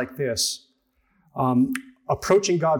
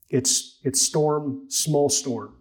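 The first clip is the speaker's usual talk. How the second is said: ordinary talk, nothing marked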